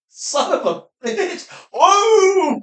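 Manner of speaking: high, loud, drawn-out whoop; imitated hunter's cry. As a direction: shout the win across the field. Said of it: "He goes".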